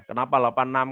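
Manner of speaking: normal talking voice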